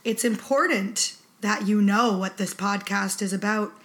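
ordinary talk, nothing marked

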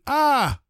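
sharp high pained cry. Acted. Rebbe crying out at a burning pain, high. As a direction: yelp as the feet burn.

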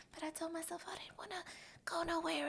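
unvoiced guilty whisper; whisper to self. Herself remembering the spending goal, in a guilty whisper. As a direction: whisper it like a secret to yourself. Voicing her broke inner self.

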